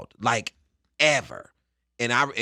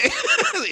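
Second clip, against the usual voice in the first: big laugh; 7 dB louder than usual. Retold or imitated. Lifer finally laughing.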